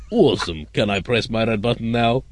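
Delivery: Brezhnev figure with a put-on Russian accent; lightly acted Soviet leader, Russian accent; comic Russian accent. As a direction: ask eagerly in a mock Russian accent. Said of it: "quite a wide range of accents".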